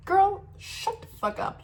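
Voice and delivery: high, slow, exasperated clapback; sassy outburst. Retold or imitated. Telling off the airport staff, slow high exasperated clapback. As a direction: draw it out in disbelief at the staff.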